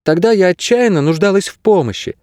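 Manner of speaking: put-on high-pitched young heroine; voiced girl character. A narrator's reading. Narrator voices the girl Kase.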